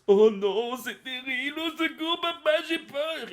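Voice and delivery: very high, whiny frightened wail; hammed-up girlish fright. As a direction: wail for daddy like a scared child. Girl scared after beheading T-Rex.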